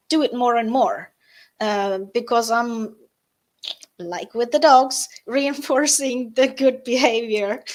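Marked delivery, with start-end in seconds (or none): 5.3-7.6 laughing. Real, not acted. Laughing at her dog comparison.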